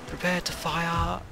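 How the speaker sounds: high, slow, drawn-out order; mock naval command. Player as captain readying the guns to fire, high mock command. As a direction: play a captain calling the gunners ready. Acting out the command once more.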